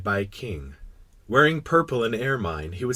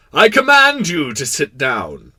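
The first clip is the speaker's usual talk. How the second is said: loud, slow, higher decree; majestic king; 5 semitones higher, 11 dB louder, slower than usual. Acted. King granting the prince a seat, majestic. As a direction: command grandly from the throne. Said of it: "majestically gathering up the fold".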